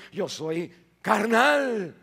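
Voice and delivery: "soy" lento y fuerte; lectura enfática. Real, not acted. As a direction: subrayar "mas yo soy carnal" despacio. Repite versículo con énfasis. ("carnal" alargado con fuerza)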